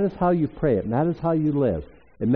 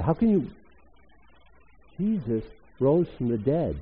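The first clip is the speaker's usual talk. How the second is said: ordinary delivery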